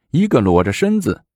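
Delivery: ordinary delivery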